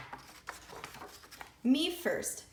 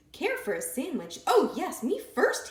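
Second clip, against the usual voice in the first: eager; 7 semitones higher, 4 dB louder than usual. A narrator's reading. Pig eager for sandwich.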